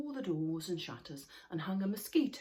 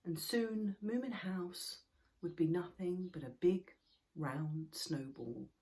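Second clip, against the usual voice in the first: plain reading voice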